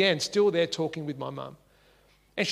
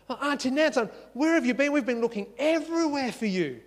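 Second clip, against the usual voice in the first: a lightly voiced boy, higher with surprise; ten-year-old boy surprised to see the woman again, higher; excited boy; 7 semitones higher than usual. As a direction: greet her like a kid who has been searching. Boy greets the returning woman.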